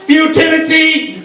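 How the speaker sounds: high, drawn-out one-word declamation; modern art comedian. Acted. Modern art comedian treating the audience like art, high drawn-out declaiming. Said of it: "what if there was a modern art comedian".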